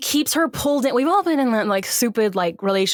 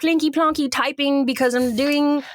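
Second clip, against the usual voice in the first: higher, mock-earnest newspaper woman; Kate Winslet; 3 semitones higher than usual. Iris announces her newspaper job, higher mock-earnest caricature. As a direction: send up a busy heroine at her typewriter. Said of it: "Kate Winslet's like".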